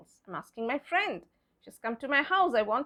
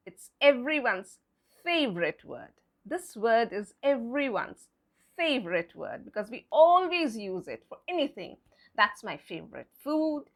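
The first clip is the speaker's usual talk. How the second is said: ordinary delivery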